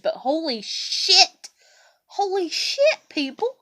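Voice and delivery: drawn-out, rising exclamation; exasperated disbelief. Real, not acted. Appealing to people flooding her, drawn-out exasperation. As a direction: stretch the swear and lift it high.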